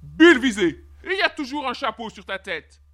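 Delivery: loud opening outburst, then stern; angry king. A narrator's reading. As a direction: burst out, then accuse sternly. Furious king accuses boy. (roi en colère, accusateur)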